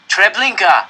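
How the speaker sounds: high, loud shout of the name; calling out. A narrator's reading. Reading the placard to the other train, shouted. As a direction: call it out across the tracks. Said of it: "I called".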